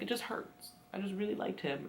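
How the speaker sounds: mild, quiet hurt, softly retold; hurt confession. Girl confiding to her friends, mildly hurt. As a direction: imitate a hurt girl, hushed and small.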